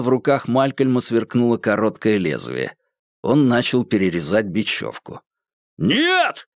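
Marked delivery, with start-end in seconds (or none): 5.8-6.5 high, sudden cry of alarm; sharp shout. Acted. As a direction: yell it out to stop him. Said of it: "крикнул Курц". (резкий крик)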